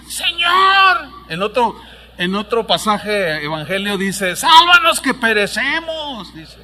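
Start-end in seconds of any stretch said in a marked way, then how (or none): 0.1-1.1 grito de discípulos. Acted. 4.4-6.3 grito lento, más agudo; clamor. Acted. Discípulos claman.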